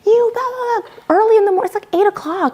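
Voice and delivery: high-pitched mock driver rant; hammed-up high yell without much volume. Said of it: "started yelling at my Lyft driver".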